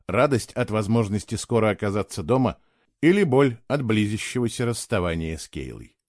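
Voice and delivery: ordinary delivery, nothing marked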